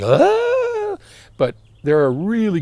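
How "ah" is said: a piercing, very high mock shriek; mock horrified shriek. Host mocking audiophiles' horror at Y connectors with a shriek. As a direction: shriek like a scandalised purist. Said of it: "a lot of people are like".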